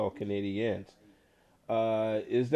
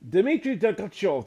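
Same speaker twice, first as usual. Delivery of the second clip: name boomed out higher and louder, stretched; announcer name call; 9 semitones higher, 6 dB louder than usual. Pretend. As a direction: call the name like a player intro over the arena speakers. Announcing the new player's name.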